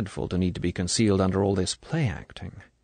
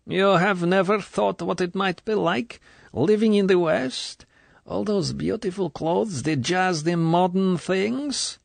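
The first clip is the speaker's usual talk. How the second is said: high, luring list of Western delights; tempting colonel; 10 semitones higher than usual. A narrator's reading. Colonel tempting the girl with the West, luring. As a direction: dangle the West before her.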